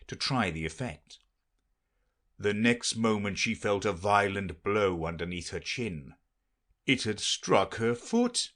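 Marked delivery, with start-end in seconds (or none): none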